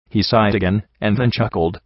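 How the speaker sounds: ordinary delivery, nothing marked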